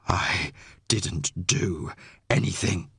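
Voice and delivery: low, slow, hoarse protest; husky, labored, deep denial; throat full of gravel. A narrator's reading. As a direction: force it out through a smoke-raw throat. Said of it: "My throat felt full of gravel".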